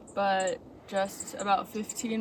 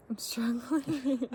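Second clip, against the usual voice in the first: whispering; 2 semitones higher, slower than usual. Real, not acted. Tired hiker whispers.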